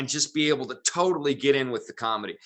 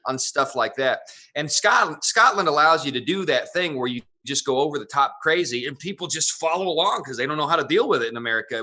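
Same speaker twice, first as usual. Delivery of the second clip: ordinary talk, nothing marked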